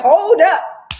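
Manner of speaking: high, loud halting call; commanding shout. Retold or imitated. God stopping the tower builders, commanding shout. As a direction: cut them off with a big, high shout.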